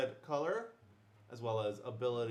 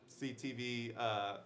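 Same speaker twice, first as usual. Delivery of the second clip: usual speaking voice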